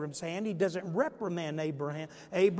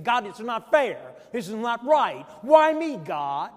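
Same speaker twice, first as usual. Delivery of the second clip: loud, rising cry of protest at God, peaking on why me; mock angry protest; 2 semitones higher, 7 dB louder than usual. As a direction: shake your fist at heaven. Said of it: "sometimes we're shaking our fists toward God".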